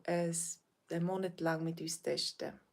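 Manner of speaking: normal talking voice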